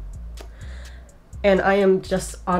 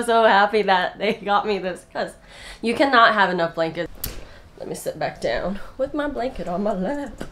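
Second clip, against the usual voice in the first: normal talking voice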